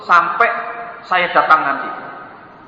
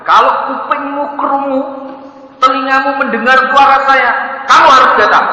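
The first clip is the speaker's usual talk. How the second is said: louder, higher, heated scolding; angry shouting call; 9 semitones higher, 4 dB louder than usual. Acted. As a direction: yell at the hiding son. Abu Bakar marah bersumpah. (Abu Bakar membentak anaknya dengan keras)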